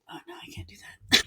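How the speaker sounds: whispered dog talking himself out of licking; playful dog owner voicing the dog. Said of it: "he'll be like".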